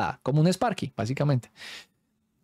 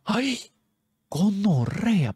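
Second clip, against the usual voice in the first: high, drawn-out shocked cry; startled cry; 8 semitones higher, much slower than usual. Retold or imitated. Scared boys reacting to the program answering, startled cry. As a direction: jump out of your skin, stretching it out.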